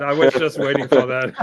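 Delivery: loud, real laughter through the words; amused, breaking into a big laugh. Real, not acted. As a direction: crack up while getting the line out. Cracking up at the French line.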